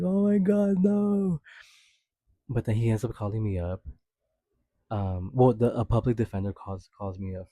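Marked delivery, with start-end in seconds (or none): 0.0-1.4 high, loud panicked "oh my God, no"; panicked dread. Retold or imitated. Fearing jail, a high, loud panicked squeal.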